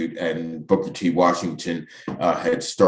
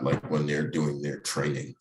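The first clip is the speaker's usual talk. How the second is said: ordinary talk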